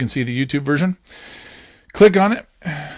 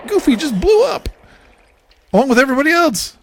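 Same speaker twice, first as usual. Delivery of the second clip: much higher and louder, laughing shock; excited outburst; 12 semitones higher, 5 dB louder than usual. Real, not acted. Parent narrating a cartoon explosion to son, excited. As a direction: blurt it out as the explosion hits.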